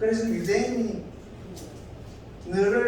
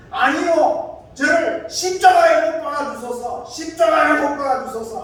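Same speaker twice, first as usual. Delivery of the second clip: high, loud chanting crowd shout; imitating a protesting crowd yelling; 9 semitones higher, 7 dB louder than usual. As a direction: chant it like a mob demanding execution. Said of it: "그렇게 고함을 지르면서".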